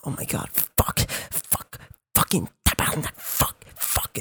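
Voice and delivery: whispered panicky cursing; frantic whisper. Retold or imitated. Girlfriend panicking at the concert, whispering curses. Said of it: "keeps fucking going, like".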